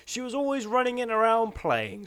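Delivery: ordinary delivery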